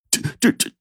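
soft, teeth-sucking stammer; dismayed stammer. A narrator's reading. Father stunned by the demand, sputtering softly. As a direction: balk at the price. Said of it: "直嘬牙花子呀".

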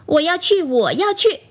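put-on high, insistent child's cry, no real shout; mock-yell like a toy doll begging along; childlike calling out. A narrator's reading. Doll begs Feifei to take it to grandma's, mild calling out. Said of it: "弟弟喊着".

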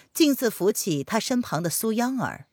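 ordinary delivery, nothing marked

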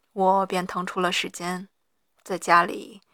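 normal talking voice

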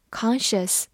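"conscious" with an American open "ah" vowel; American pronunciation. Shown as a demonstration. Teacher showing learners the US variant, American pronunciation of "conscious". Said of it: "美式发音".

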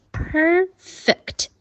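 high, drawn-out "perfect", both syllables separated; careful "perfect" model. Shown as a demonstration. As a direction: pronounce "perfect" slowly, clear short i in the second syllable. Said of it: "发一，一的音".

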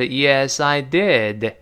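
"I did" slowly, final d of "did" released. Shown as a demonstration. Teacher shows slow reading, "did" drawn out and released. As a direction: say "I did" slow with a full ending on "did". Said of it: "读慢了还是".

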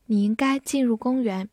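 usual speaking voice

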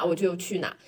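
ordinary talk, nothing marked

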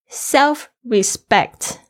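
Self-respect drawn out very slowly, each sound clear; slow demo. Shown as a demonstration. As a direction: stretch Self-respect out for the class. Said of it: "注意一下self-respect". (极慢地示范Self-respect)

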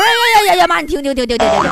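acted flustered outcry, tumbling over the words; frantic interruption. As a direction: cut in in mock panic. Daughter cutting off mom's revelation.

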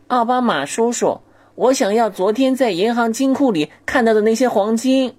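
put-on high, slow kid's whine; a spoiled kid asking his uncle for gold; bratty child voice. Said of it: "狗不理总统风趣地说".

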